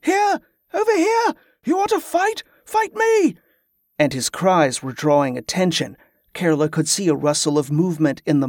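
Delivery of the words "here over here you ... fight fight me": high-pitched, urgent yelling; shouting at top of lungs. A narrator's reading. Jordan taunting the mob to draw attention, high shouting. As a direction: yell it out to draw the whole crowd over. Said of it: "He was shouting at the top of his lungs".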